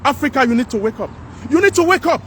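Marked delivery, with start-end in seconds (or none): 1.5-2.2 urgent plea. Real, not acted. Urging Africa to wake up.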